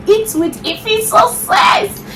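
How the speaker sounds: high, slow, shouted catchphrase; channel slogan shout. Real, not acted. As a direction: belt out the show name.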